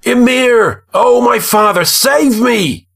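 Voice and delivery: high-pitched desperate cry to a father; ringing, sustained high plea for rescue. A narrator's reading. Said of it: "cried out in a voice that rang".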